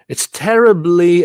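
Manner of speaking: "terribly" high and loud on its first syllable; stress demo. Shown as a demonstration. Teacher showing the first stress, "terribly" punched high and loud. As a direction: hit the TER of "terribly" hard. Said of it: "and then the next stress".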